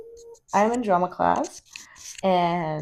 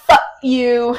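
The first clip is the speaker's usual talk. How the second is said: playful yell; 4 semitones higher, 9 dB louder than usual. Real, not acted. Playful comeback to sexist joke.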